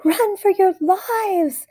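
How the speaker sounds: high, slow drawn-out panic; panicked cry. A narrator's reading. Explorer fleeing the monster, high slow panicked cry. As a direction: stretch it out in comic terror. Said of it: "cried Fluffy the Explorer".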